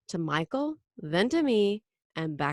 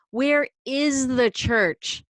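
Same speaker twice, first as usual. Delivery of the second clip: slow, louder, higher demand; loud question; 5 semitones higher, 6 dB louder, much slower than usual. Acted. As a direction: ask it loudly, drawing each word out. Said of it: "I asked loudly".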